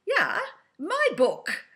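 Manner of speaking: put-on slow, loud, high German-accented exclamation; proud, booming German-accent reply. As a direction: imitate the German lady, drawn out and emphatic. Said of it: "please excuse the German accent".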